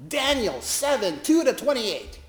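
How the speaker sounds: high, pressing challenge; mock preacher quizzing. Preacher pressing the chaplain with another verse, challenging. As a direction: keep hammering her with verses.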